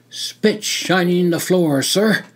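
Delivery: answering the officer, surprised but proud; taken aback yet pleased with himself; surprise and pride. A narrator's reading. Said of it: "with a mixture of surprise and pride".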